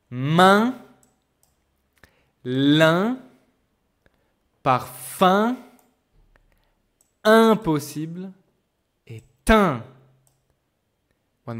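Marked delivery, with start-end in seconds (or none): 0.1-9.8 main, lin, parfum with loud rising nasal vowels; teacher reading example words. Shown as a demonstration. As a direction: say each word slowly with the nasal vowel ringing. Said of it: "Donc on a".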